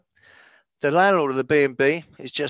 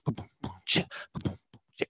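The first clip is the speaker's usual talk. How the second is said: beatbox; 8 semitones lower, 8 dB quieter, faster than usual. Pretend. Beatbox intro to poem.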